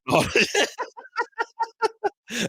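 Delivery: high burst of laughter; laughing. Real, not acted. Podcaster cracking up retelling the doctor's questions, laughing.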